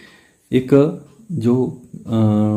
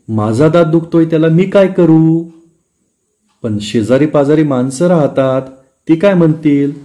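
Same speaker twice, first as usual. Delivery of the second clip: raised, louder, higher mock exasperation; exasperated complaint; 4 semitones higher, 5 dB louder than usual. Husband and wife bicker over his toothache noise, hammed-up exasperation. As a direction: throw up your hands, a bit loud.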